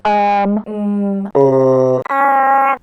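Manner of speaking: long droned "um, mm, uh"; hesitation fillers. Shown as a demonstration. Teacher shows pauses to avoid, drawn-out fillers. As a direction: hum out drawn filler noises. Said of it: "avoid using".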